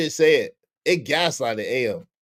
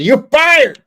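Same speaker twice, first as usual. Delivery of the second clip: loud, high "You're fired!"; mock firing shout; 11 semitones higher, 10 dB louder than usual. Firing the misbehaving bot, loud mock shout. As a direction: bark it like a fed-up boss. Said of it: "I was like".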